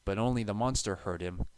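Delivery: ordinary delivery, nothing marked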